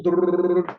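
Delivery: rolled-tongue drum roll; imitated drum roll. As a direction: trill it like a snare drum. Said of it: "there's the drum roll".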